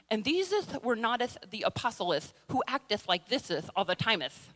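mock King James lisp, every s as th; joking Bible-English lisp. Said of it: "This is how the King Jameth readeth".

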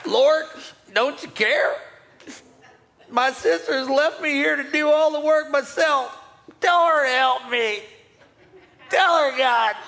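high, pleading whine; whiny complaint. Acted. Martha whining to the Lord about her sister. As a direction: beg God to make her sister help.

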